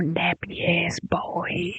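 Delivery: sly whispered insult; a whispered dig slipped in mid-story; whispered jab. Real, not acted. Insult dropped into the description.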